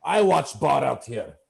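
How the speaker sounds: gruff orc in broken English; big orc boss. Acted. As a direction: take charge as the orc boss. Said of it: "and he says".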